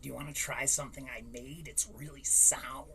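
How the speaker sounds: low, soft, fast conspiratorial offer; a hushed, sneaky kid voice; sly kid offer. Retold or imitated. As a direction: slip it to him low and quick. Kid offering his powder.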